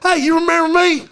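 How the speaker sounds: high, eager mock greeting; imitated eager tourist. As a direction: hail him like a long-lost pal. Mimics man greeting him eagerly.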